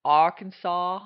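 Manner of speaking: loud Arkansas drawl, "Arkansas" with an open mouth. Shown as a demonstration. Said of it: "kind of an open mouth sound".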